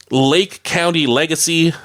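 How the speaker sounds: ordinary delivery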